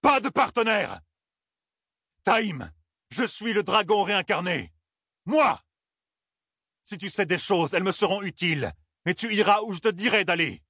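voix de fureur, bien plus haute, dominatrice; rugissement furieux. Acted. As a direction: imposer son autorité en rugissant. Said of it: "rugit-il". (Rand, hors de lui, impose sa loi à Taïm)

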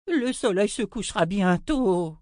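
put-on high urgent old-wizard outburst; roaring. A narrator's reading. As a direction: cry out a warning. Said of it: "rugit le vieux sorcier".

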